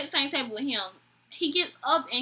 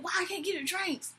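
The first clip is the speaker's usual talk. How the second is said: high-pitched imitation of a mad drunk; mock indignant complaint; 7 semitones higher, 6 dB quieter than usual. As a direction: complain as the refused customer, pitched up. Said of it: "so freaking mad".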